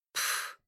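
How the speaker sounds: short, soft dismissive puff; пренебрежительное фырканье. A narrator's reading. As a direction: puff out air, unimpressed. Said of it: "Дуся закатила глаза". (тихое презрительное «пф»)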